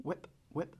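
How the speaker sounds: soft popping bubble cluck; tongue-click cluck like a hen turkey. Shown as a demonstration. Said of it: "to get a bubble cluck is to go whip".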